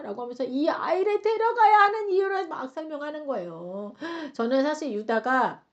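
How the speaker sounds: normal talking voice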